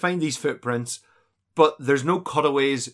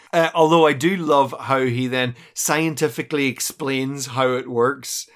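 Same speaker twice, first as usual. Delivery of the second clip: usual speaking voice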